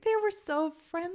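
high, mock-sweet gush. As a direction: tease her by gushing like she does. Mocking how S0 describes staff.

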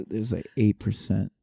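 slow, loud, deep comic voice; put-on low voice, drawn out; cock voice. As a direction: push it low and loud, taking your time. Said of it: "sounds better in the cock voice".

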